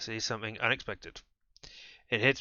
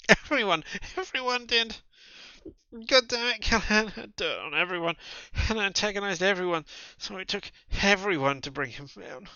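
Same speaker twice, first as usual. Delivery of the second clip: high, loud, frantic outburst; frantic rant; 9 semitones higher, 4 dB louder than usual. Acted. Cornered man ranting at the detective. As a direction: shout it like it's all unravelling.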